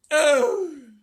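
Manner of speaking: a long dismayed wail. A narrator's reading. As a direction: grandmother wailing in despair. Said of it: "wailed Grandma Georgina".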